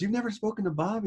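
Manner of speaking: a shocked intern who can't believe it; disbelief. Retold or imitated. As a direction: pitch jumps way up, incredulous question. Other intern reacts in disbelief.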